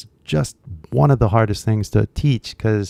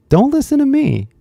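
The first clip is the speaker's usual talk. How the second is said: squeaky high voice. Pretend. Jokey self-mocking aside.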